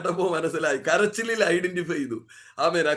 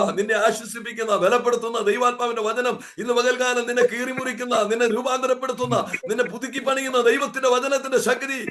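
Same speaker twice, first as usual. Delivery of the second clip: fervent preaching; 4 semitones higher than usual. Real, not acted. Preacher proclaiming.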